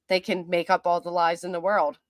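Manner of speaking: ordinary talk, nothing marked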